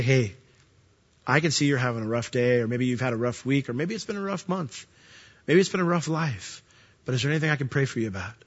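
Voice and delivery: gentle reply. Retold or imitated. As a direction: speak softly to calm a hurting person. Gentle kind reply to snippy person.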